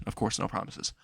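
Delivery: resigned whisper under the breath; whispered aside. Acted. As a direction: breathe it to yourself, defeated. Izuku mutters resignedly.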